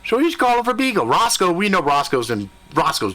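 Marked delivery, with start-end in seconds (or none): none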